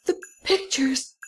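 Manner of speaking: very high-pitched cry of shock; choked, high-pitched outcry; stammered, choked cry. A narrator's reading. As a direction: cry out high, choking on the words. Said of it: "the Baron cried out".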